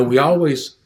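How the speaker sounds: ordinary delivery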